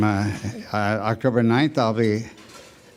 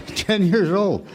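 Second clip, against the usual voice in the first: slow, laughing repeat, pitched up; amused disbelief; 6 semitones higher, slower than usual. Real, not acted. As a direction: savor the absurdity with a chuckle.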